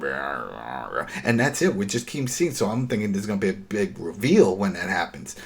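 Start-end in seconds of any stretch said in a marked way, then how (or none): 0.0-1.1 growling noise. Retold or imitated. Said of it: "He's like".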